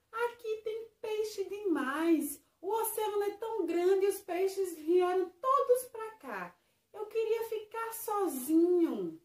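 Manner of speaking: a hint of a cranky little fish; Pipoca's grumbling voice. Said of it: "E o Pipoca falou". (voz de peixinho resmungão)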